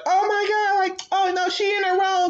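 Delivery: put-on high, gushing girl voice; mock girly side chick. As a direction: gush in a high girly pitch. Side girl voiced taking his side.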